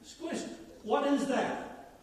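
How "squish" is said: "Squish" said high and softly; model word again. Shown as a demonstration. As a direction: prompt the crowd with "Squish". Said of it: "Say it again".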